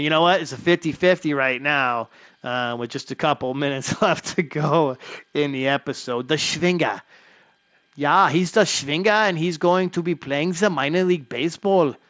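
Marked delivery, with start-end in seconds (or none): 6.3-12.0 hammed-up mock German accent; jokey German ballplayer accent, badly faked; horrible German accent. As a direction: put on a bad German accent for the player. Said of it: "horrible accent".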